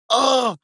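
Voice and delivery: high, sharp pained oh; a yelp of pain on oh. Retold or imitated. Stripper showing how a correct tie sounds, high pained oh. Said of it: "if you hear the person go".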